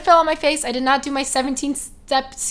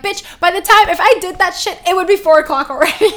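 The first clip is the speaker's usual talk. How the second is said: loud, fed-up blurt with a laugh; exasperated outburst; 5 semitones higher, 11 dB louder than usual. Pretend. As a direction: burst out in disbelief. Mocking the perfect routine, exasperated.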